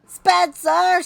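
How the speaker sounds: high, loud outraged yell; piercing scolding shout; scream. Acted. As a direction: scream the name like a furious mom. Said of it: "she screamed".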